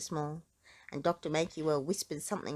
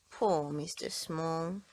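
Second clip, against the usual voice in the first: usual speaking voice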